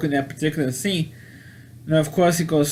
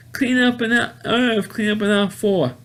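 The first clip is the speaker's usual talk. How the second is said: high-pitched paging call; sing-out intercom call, pitched high; store PA announcer; 6 semitones higher than usual. Retold or imitated. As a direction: imitate a supermarket cleanup announcement. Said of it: "the guy in the P A system".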